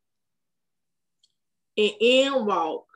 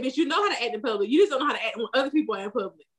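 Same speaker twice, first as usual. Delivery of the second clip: fast retort; much faster than usual. Retold or imitated. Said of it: "She said".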